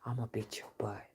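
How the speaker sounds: soft threatening whisper; mother whispering a threat; menacing whisper. Retold or imitated. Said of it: "She didn't use that word".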